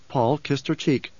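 plain reading voice

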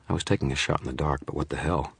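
plain reading voice

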